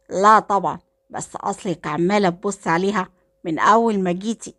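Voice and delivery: ordinary delivery, nothing marked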